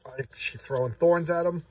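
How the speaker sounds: ordinary talk, nothing marked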